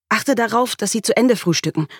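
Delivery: speaking fast. A narrator's reading. Mother instructing her husband about breakfast, hurried. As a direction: press on urgently. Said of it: "Ich begann, schnell zu sprechen".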